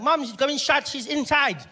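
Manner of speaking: put-on high, loud child's voice; little brother voice. Young brother telling about mom, high child voice. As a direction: play the little brother blurting it out.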